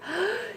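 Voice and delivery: sharp rising gasp of delight; a happy intake of breath on seeing the cat. Real, not acted. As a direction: gasp as if the cat just appeared. Greeting the cat in the morning.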